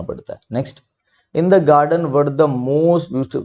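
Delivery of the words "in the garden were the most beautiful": very slow, word-by-word read of an example sentence; slow dictation reading. Shown as a demonstration. Teacher reading an exercise sentence to students, slow and deliberate.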